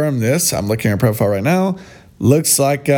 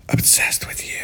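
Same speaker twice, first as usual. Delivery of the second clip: breathy whisper of the comment; mocking whisper; whispered mock repeat; 6 dB quieter than usual.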